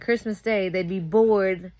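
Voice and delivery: usual speaking voice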